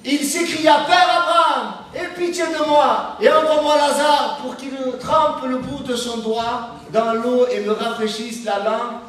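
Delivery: solemn Bible reading from the pulpit; scripture reading. Acted. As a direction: lift the voice and read the verse like scripture. Reads the rich man's plea.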